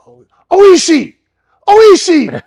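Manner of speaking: high, triumphant full-volume shout; screaming. Retold or imitated. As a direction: yell it like a thrilling discovery. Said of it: "this big gaijin just screaming".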